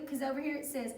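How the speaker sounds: usual speaking voice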